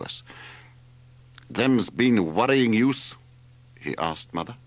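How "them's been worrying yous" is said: put-on slow, higher, accented character voice; gruff Greek driver. A narrator's reading. Driver asking Mother, slow accented character voice. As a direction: draw it out as the foreign cabman.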